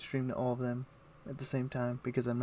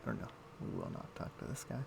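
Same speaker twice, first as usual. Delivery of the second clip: whispered mutter; 9 dB quieter than usual. Real, not acted. Muttering to self while playing.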